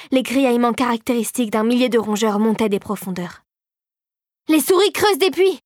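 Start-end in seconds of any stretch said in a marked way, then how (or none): 4.5-5.6 high, alarmed exclamation; alarmed realisation. A narrator's reading. Philippe grasps what the mice are doing, high alarmed cry. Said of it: "saisit Philippe".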